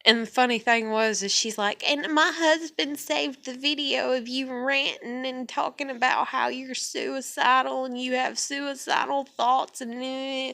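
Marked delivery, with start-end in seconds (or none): 1.9-10.5 higher-pitched mocking mimicry, trailing into nonsense; mocking imitation. Mimicking a woman's threat about a video, mocking and higher. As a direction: parrot a complainer in a higher voice.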